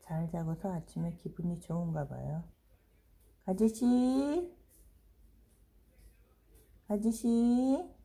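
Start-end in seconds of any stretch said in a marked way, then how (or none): 3.4-7.9 drawn-out call. Real, not acted. Calling dog, drawn out.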